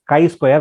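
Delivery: "chi-square" with a hard K, as KAI-square; correct pronunciation. Shown as a demonstration. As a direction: say "chi-square" to rhyme its first syllable with sky. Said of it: "You have to ma-, uh, pronounce as".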